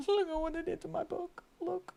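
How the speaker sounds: high, dismayed complaint; dismayed whine. Real, not acted. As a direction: whine about the ruined book. Showing damaged book, dismayed.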